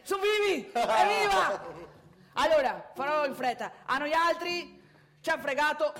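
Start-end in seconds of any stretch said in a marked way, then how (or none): 0.0-1.9 high, loud stage celebration; loud cheer. Pretend. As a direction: cry out in triumph to the crowd. Performer cheers audience's response. (esultanza gridata)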